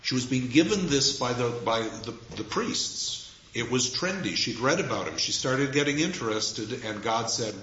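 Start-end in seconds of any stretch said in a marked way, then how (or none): none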